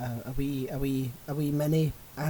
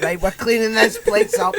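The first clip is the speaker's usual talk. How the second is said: loud, higher, laughing mock order; laughing burglar quote; 8 semitones higher, 11 dB louder than usual. A pretend burglar sees the messy house, laughing loud quote. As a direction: bark it out like a fussy burglar through a laugh. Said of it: "and went".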